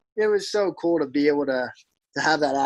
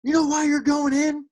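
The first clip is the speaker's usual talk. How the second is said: high, jeering heckler voice; mock heckler jeer, voice pitched up; 11 semitones higher than usual. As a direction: taunt the pitcher from the stands. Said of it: "all I hear is".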